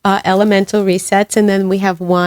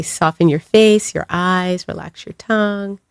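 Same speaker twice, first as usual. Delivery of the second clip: guided meditation; 2 semitones lower, 4 dB quieter than usual. Acted.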